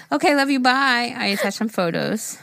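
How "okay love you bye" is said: high cutesy "okay, love you, bye"; sing-song sign-off. Retold or imitated. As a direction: chirp the goodbye up high. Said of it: "She said".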